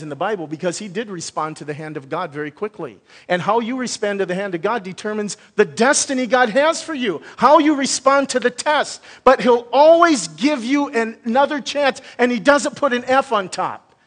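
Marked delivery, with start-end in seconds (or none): 3.3-13.9 higher and louder, the preacher's voice climbing to a peak; full-force preaching. Acted. Pastor rallying the congregation about retakes from God, full-force preaching. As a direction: drive it home from the pulpit.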